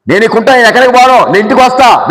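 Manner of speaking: high, fast, emphatic promise; acted reassurance. Wife promises husband she will stay, emphatic reassurance.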